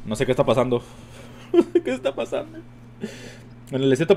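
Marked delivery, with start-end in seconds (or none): 1.5-2.6 high squeaky laugh through the words; laughing falsetto. Real, not acted. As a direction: giggle it out in disbelief. Risa en falsete. (riendo en falsete)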